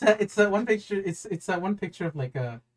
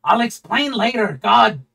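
loud, higher, drawn-out yell; exasperated yell; 5 semitones higher, 9 dB louder, slower than usual. Acted. [name] immediately yelling at Critch, exasperated yell. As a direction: yell it out slowly and loudly. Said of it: "immediately yell at Critch".

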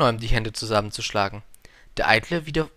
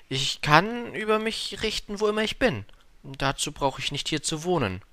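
higher, lightly voiced young prince; a hint of a small prince objecting; little prince; 6 semitones higher than usual. A narrator's reading. Little prince answers the king, lightly voiced and high.

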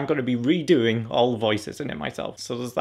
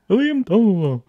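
calling out; 5 semitones higher, 6 dB louder than usual. Acted. Name called on take.

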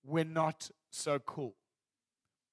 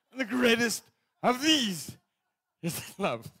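grand scripture reading; 7 semitones higher, 11 dB louder than usual. Acted.

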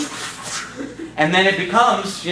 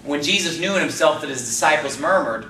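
ordinary talk, nothing marked

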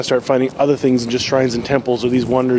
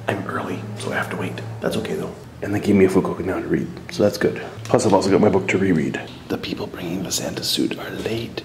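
quiet; 7 semitones lower, 6 dB quieter than usual. Real, not acted. Waiting quietly in office.

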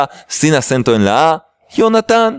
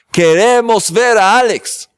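raised-pitch mock rallying cry; pretend protest slogan; 7 semitones higher than usual. Host rallies viewers to demand the cameraman, mock protest cry. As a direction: call it out like a playful protester. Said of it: "Hacer una protesta enorme".

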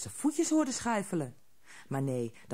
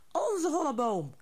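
troll chorus echo; 9 semitones higher than usual. A narrator's reading. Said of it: "wisten ook de andere trollen zeker".

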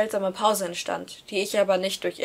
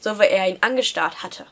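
plain reading voice

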